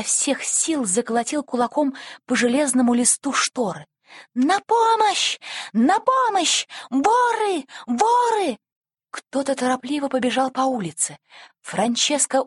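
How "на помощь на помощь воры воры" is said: high, loud, drawn-out shouts; cry for help. A narrator's reading. Boy waking the street about thieves, shouting for help. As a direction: raise the alarm at the top of your voice. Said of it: "Франческо закричал".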